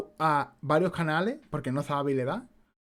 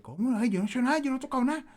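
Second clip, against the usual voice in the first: high, flustered mock mother protesting innocence; nervous mother; 9 semitones higher, faster than usual. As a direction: imitate a panicky mom insisting she touched nothing. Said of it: "Porque ella se pone muy nerviosa".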